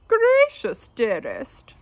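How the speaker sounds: high, loud genteel lady voice; fussy wife, voiced high and loud; prim wife. A narrator's reading. Wife chiding her husband, high and loud. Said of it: "she said to her husband".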